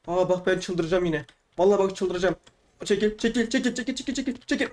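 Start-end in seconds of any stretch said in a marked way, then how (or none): 2.8-4.7 rapid repeats climbing higher; anxious urging. Real, not acted. Player urging an obstacle aside, anxious and climbing in pitch.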